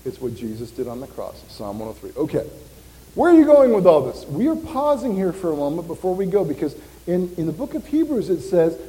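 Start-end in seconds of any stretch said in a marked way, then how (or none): 3.1-4.2 loud, quick, high-pitched challenge; challenging question. Acted. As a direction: voice a puzzled listener cutting in. Voices listener's challenge to him.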